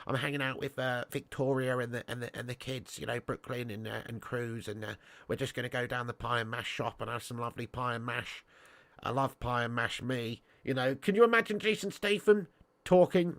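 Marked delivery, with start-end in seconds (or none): 0.0-10.4 soft, softer mock Beckham voice, light and polite; a gentle send-up of a soft-spoken celebrity footballer; softer, lower-key Beckham impression, chatty and sweet; imitating David Beckham. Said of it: "David Beckham's got, like, this type of a voice".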